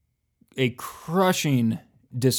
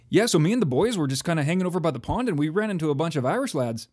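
fast, sing-song put-on Canadian; hammed-up Canadian storyteller accent; broad Canadian accent; 3 semitones higher, faster than usual. Shown as a demonstration. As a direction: rattle it off in a stock Canadian accent. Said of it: "I wasn't Canadian enough".

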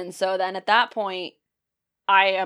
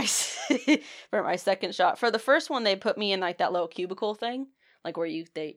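normal talking voice

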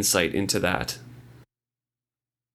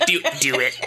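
laughing; 6 semitones higher than usual. Real, not acted. Laughing before the impression.

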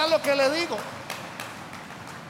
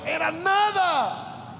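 drawn-out emphasis; 4 semitones higher, much slower than usual. Real, not acted. Preacher stressing point.

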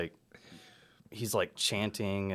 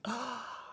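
high, soft mock gasp; delighted gasp; 7 dB quieter than usual. Retelling his reaction to the church fire post, delighted gasp. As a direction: gasp as if at wonderful news. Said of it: "and I was like".

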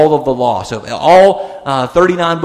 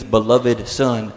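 ordinary talk, nothing marked

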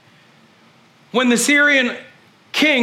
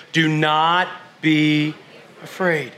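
gentle reassurance; 5 semitones lower, slower than usual. Shown as a demonstration. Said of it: "Turn to your neighbor and say".

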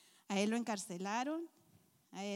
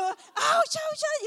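high, loud ouch cries; mock pained yelp; 9 dB louder, much slower than usual. Sore legs, yelping ouch down the stairs. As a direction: yelp each ouch like it stings.

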